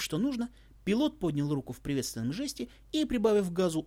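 plain reading voice

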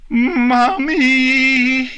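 put-on loud, very high, drawn-out child's wail; full-force cry. Nix cries for her mother from the chariot, full force. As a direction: cry out with all a small voice's force. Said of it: "with all the force and volume".

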